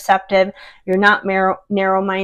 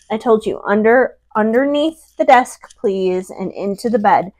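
ordinary talk

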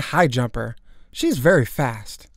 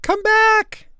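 very high, loud cry; scream; 5 dB louder than usual. A narrator's reading. Girl to her flying pet, high desperate scream. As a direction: scream after someone getting away. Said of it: "I screamed".

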